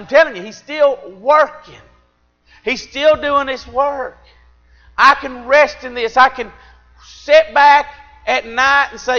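high, loud preaching climax, held fall on all; full-force preaching. Acted. Preacher to his church, loud full-force preaching. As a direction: preach it out hard to the congregation.

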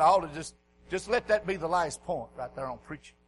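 ordinary talk, nothing marked

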